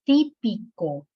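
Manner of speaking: típico slowed into TÍ-pi-co; syllable by syllable. Shown as a demonstration. Teacher showing the stressed syllable, típico slowly syllable by syllable.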